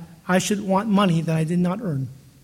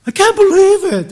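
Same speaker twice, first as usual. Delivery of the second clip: loud, high mock wail; crying man; 9 semitones higher, 10 dB louder than usual. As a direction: wail it out in hammed-up despair. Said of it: "and he's crying".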